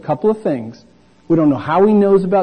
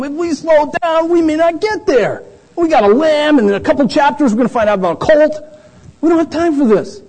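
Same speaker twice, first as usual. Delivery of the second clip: higher, put-upon disciple voice; higher mock disciple voice; self-important disciple; 9 semitones higher than usual. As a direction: sound like a follower in a hurry. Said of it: "they're like".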